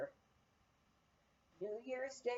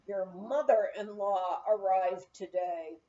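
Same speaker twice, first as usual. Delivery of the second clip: through tight lips; 4 semitones lower, 3 dB louder than usual. A narrator's reading. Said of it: "said through tight lips".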